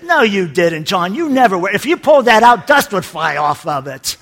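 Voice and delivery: wife exposing her husband, loud and scornful; mocking wife. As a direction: call him out loudly, scoffing. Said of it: "and the wife says".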